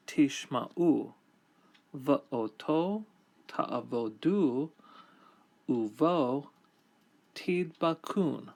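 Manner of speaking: slow, measured reading of Hebrew scripture. Acted. Teacher reading the Hebrew verse to students, slow and measured. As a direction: read each phrase with deliberate pauses.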